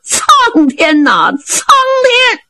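drawn-out high cry of despair; distant stranger crying out in anguish; wailing to heaven. Acted. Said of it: "有人叫".

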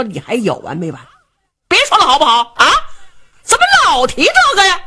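fed-up son. Acted. Son cutting father off.

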